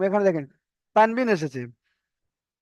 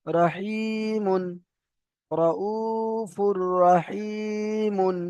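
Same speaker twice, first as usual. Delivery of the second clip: drawn-out recitation; 3 semitones higher, much slower than usual. Shown as a demonstration. Teacher demonstrates joined Quran reading.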